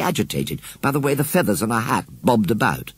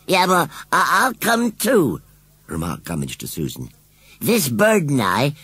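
higher, slow rustic voice; Gummidge; 7 semitones higher than usual. A narrator's reading. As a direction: chime in like a bumpkin. Scarecrow joins in.

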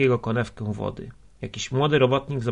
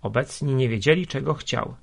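plain reading voice

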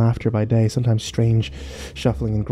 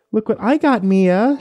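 put-on high sing-song voice to a toddler; sing-songy cooing; 10 semitones higher than usual. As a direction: coo it brightly to a small child. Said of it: "she cooed in a sing-songy voice".

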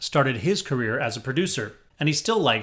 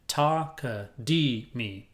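Ta ka di mi spoken slowly, each syllable apart; slow, separated syllables; much slower than usual. Shown as a demonstration. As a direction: break Ta ka di mi into four slow even syllables. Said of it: "shoved a ka in the middle and put a mi at the end".

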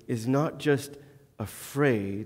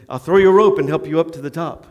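eager offer; 3 semitones higher, 11 dB louder, faster than usual. Retold or imitated. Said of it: "And the Spanish guy says".

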